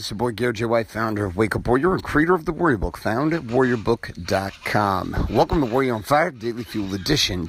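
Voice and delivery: announcer intro. Acted.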